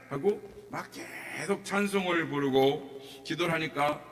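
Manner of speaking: ordinary talk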